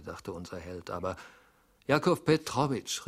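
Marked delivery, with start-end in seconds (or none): 1.9-3.1 high, loud, pleading call; pitiful cry. A narrator's reading. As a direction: cry out the name in misery. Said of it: "rief er kläglich". (kläglicher Ruf)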